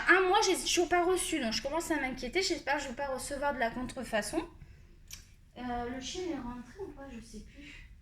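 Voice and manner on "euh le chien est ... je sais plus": quiet aside, turned away; murmured to herself; muttering to herself. Real, not acted. Wonders aloud about the dog.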